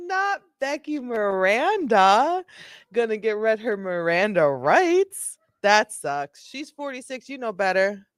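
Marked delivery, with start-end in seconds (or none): 0.1-2.4 high, drawn-out playful lament; mock dismay. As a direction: wail the name in hammed-up disappointment. Mock dismay at suspect's name.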